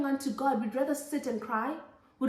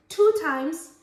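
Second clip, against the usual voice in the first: loud emphasis; 4 semitones higher, 7 dB louder than usual. Real, not acted. Preaching emphasis.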